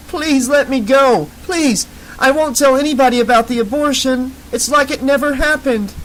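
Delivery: high, slurred, desperate pleading; drunken terrified begging. A narrator's reading. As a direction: beg drunk and scared. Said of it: "slushy, drunken words ... beaten and terrified".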